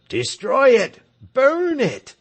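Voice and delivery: high, loud furious cry; angry crowd cry. Acted. Angry villagers demanding the tyrant's hat burn, loud cry. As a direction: roar for the hated hat to burn.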